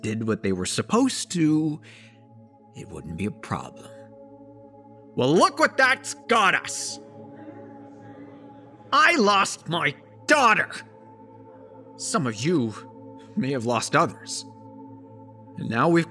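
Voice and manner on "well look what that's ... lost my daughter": raised, high-pitched cry to a crowd; bereaved father's emphatic outburst; anguished angry outburst. Acted. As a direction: hit each phrase with grief and fury.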